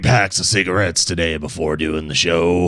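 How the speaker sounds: rough, raspy smoker's voice; hoarse smoker voice. Pretend. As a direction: croak it like a heavy smoker. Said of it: "That type of, that type of sound".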